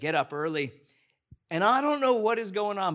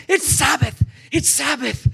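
loud, high-pitched shout of excitement; excited shouting; 8 semitones higher, 9 dB louder than usual. Retold or imitated. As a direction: burst out shouting, thrilled it's the big day. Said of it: "went like this".

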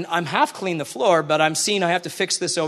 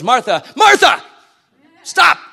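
higher and louder, a commanding halt; firm call to stop; 5 semitones higher, 8 dB louder than usual. Retold or imitated. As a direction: cut her off sharply. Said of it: "I think of it two ways where Jesus goes".

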